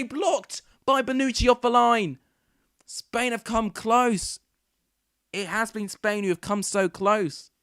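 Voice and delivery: loud, high, urgent play-by-play; excited commentary. Real, not acted. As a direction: call the chance like a live commentator. Live commentary, chance on goal.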